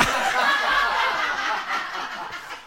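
breathless unvoiced laugh; wheezy silent laugh after the joke; silent wheezing laugh. Real, not acted. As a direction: laugh without voice, air only.

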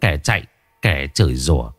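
plain reading voice

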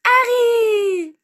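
put-on shrill, loud, long-held child's shout; high-pitched cry. A narrator's reading. Little boy calls his godfather across the atrium, shrill cry. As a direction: shriek the name out high and long. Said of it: "un cri aigu retentit".

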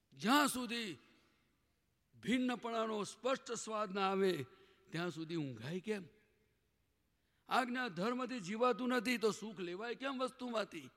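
high, loud rhetorical challenge; impassioned preaching. Real, not acted. Preacher challenging the listeners, loud and high. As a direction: press the question hard on the congregation.

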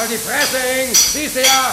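high, loud angry retort; furious shout; angry snap. Acted. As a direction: snap at him to shut up. Snapping at intruder.

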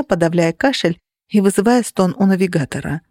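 ordinary delivery

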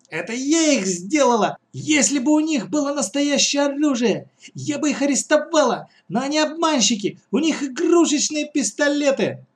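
put-on very high girlish outburst; high-pitched, sustained protest; indignant girl. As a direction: rant in a high child's voice. Girl indignantly defends herself in story.

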